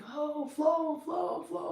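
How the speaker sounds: high sing-song fawning; mock fawning admirers; fawning friends. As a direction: gush over her. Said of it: "everybody was like".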